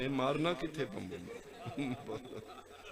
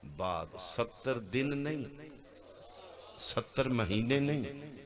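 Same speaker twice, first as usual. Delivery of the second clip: ordinary talk, nothing marked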